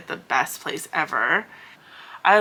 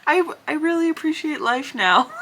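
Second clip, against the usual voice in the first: much higher, hammed-up thank-you; mock grateful; 9 semitones higher than usual. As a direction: play up the gratitude, pitched up. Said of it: "I was like".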